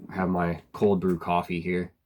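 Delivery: usual speaking voice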